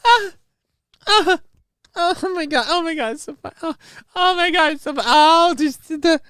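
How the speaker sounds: very high, breathless squeals of put-on amusement; mock fake laughing reaction. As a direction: mimic a phony giggling reactor. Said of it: "she just filmed herself going".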